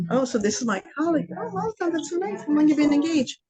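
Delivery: high, gushing welcome voice; a mock sweet hostess greeting. Host's wife greets engaged couple.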